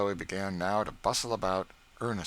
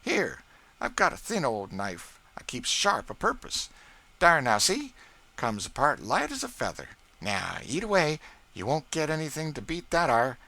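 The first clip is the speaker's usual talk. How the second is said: Aunt Chloe's voice, higher and louder, boasting; the cook scoffing at a spoiled cake, pitched up; Aunt Chloe proud of her cake; 8 semitones higher, 7 dB louder than usual. A narrator's reading. Cook to young master about her baking, proud and scornful.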